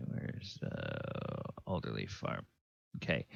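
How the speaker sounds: under his breath, checking his notes; muttered aside. Real, not acted. GM whispering to himself over his notes. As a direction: whisper it to yourself.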